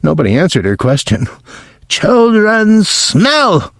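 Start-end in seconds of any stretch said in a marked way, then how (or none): 1.9-3.7 put-on much higher, slow witch scream with a German-style accent; furious demand for an answer; Grand High Witch screaming. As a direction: stretch WHY into a high screech. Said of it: "she screamed".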